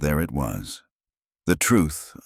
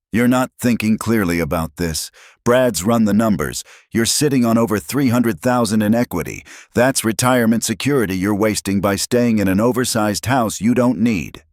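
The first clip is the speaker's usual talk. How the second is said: higher-pitched, pressing argument with a hard edge; icy insistence; 7 semitones higher, 4 dB louder than usual. A narrator's reading. Daughter pressing dad to sell the house, icy and insistent. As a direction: press the point, cold under the surface. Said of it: "Jennifer's voice had ice under the surface now".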